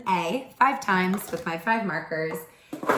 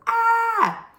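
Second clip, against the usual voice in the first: sharp high frightened gasp, much louder; scared gasp; 5 dB louder than usual. Shown as a demonstration. Teacher acting out the scared letter with a gasp. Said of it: "A is a scared letter and it goes".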